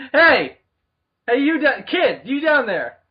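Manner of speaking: loud jeering call across a theater; heckling shout. Acted. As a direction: yell down at the kid.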